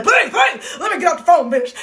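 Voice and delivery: high, loud begging with a laugh; mock desperate pleading. Woman begging friend to let her off the phone, mock desperate pleading. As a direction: beg frantically, half joking. Acted desperate plea to end call.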